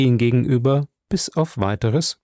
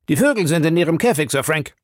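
a lightly raised, quick detective voice; brisk hotel detective; voiced London detective; 5 semitones higher, faster than usual. A narrator's reading. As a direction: report it briskly, a little higher.

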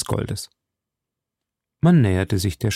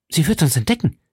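anxious; 5 semitones higher, faster than usual. Acted. Milady ängstlich.

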